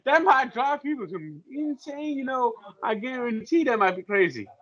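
high Cajun chef impression; Justin Wilson. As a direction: open on a big whoop, then Cajun patter. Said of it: "talk like that for the full hour".

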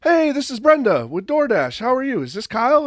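mock cheerful caller, pitched high; high chirpy rep voice. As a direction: mimic the sales rep in a high voice. Imitating Brenda's third call.

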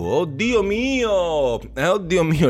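high, drawn-out oh my god; mock dismay. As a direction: stretch it out, mock shocked. Reacting to a headline.

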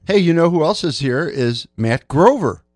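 ordinary talk, nothing marked

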